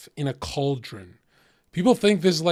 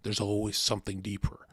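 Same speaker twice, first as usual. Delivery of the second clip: lower, softer, patient; calm wise brother; 5 semitones lower, 3 dB quieter than usual. Retold or imitated. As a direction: explain it gently like a therapist. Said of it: "He's like".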